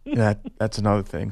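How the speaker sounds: mock tearful athlete, halting with long pauses; half-hearted choked-up interview voice, breaking off mid-thought; fake choked-up crying. Host tries faking a tearful player interview, choked up. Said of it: "let's see if I can".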